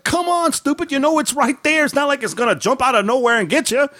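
man berating himself after banging his knee, high and exasperated; scolding himself. Retold or imitated. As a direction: chide yourself for tripping again. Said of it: "I was like".

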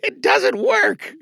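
strained high slow shout; mock proof that the high voice fails; failed character voice. Shown as a demonstration. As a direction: squeeze out a high voice that cracks. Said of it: "And guess what?".

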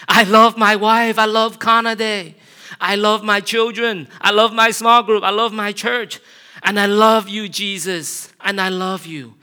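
high, loud shouted declarations; shouting. Retold or imitated. Pastor shouting what he loves while driving, loud joyful shout. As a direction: shout each love out as if to drown the anger. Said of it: "but I shout my wife's name".

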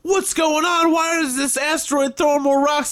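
high, bewildered mock character voice; mock panicked characters. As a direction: squeak it out like clueless astronauts. Mocking panicked movie characters.

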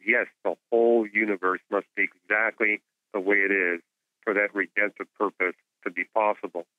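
normal talking voice

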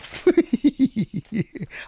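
high-pitched stuttering giggle; high laugh. Real, not acted. Podcaster laughing at his own forgetfulness, high giggle. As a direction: burst into a high run of giggles. Laughs at having forgotten top moment.

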